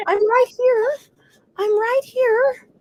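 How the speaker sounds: high little dog voice; voicing the dog. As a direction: speak for the dog in a lifted baby voice. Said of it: "She's like".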